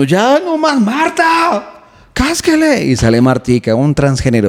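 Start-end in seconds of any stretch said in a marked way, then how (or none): 0.0-2.9 much higher, exasperated plea; fed-up boyfriend. Acted. Boyfriend calling Marta to hit his girlfriend, high exasperated call. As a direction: call for someone to step in and hit her. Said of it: "Y que uno".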